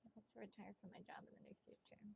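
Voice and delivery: very soft, whispered repetition; hushed, barely audible concentration. Real, not acted. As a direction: whisper it to yourself. Murmuring question to the cards.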